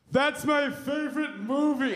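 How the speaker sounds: lightly hammed-up kid voice; imitating a classmate. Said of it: "he just goes".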